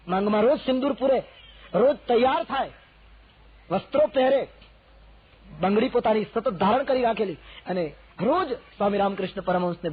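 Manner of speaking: loud, high, building fervent preaching; rising passion as the list grows; rising fervour. Real, not acted. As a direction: lift the voice with devotion.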